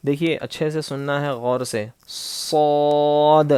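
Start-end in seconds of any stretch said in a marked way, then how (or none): 2.1-3.5 slow heavy صاد with a whistling s; said bold with whistle. Shown as a demonstration. Teacher shows students a heavy whistling letter, slow. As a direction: draw صاد out thick and hissing. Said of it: "ہم موٹا پڑھیں گے".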